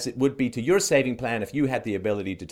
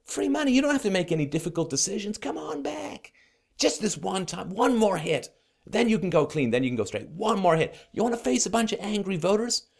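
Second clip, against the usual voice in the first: high-pitched wheedling dealer voice; coaxing drug pusher; 6 semitones higher than usual. Acted. Euro pushers tempting an addict back, coaxing drug pusher. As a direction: lure an addict back with a sly sales pitch. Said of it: "they're gonna be always out there saying".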